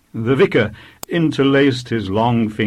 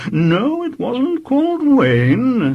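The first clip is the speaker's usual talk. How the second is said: high, slow, strained vicar; tense, slight quaver; 4 dB louder, slower than usual. A narrator's reading. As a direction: correct the child with patience running out. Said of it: "his voice quavering a little".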